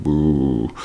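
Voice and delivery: one long drawn-out boo; mild demonstration of a jeer; crowd booing. Shown as a demonstration. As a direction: imitate a football crowd booing. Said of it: "when you boo someone".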